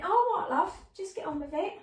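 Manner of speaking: put-on high, loud London old lady, dismissive; brisk cockney mum brushing it off; London accent. Older Londoner to healer, impatient London accent. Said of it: "she was a Londoner".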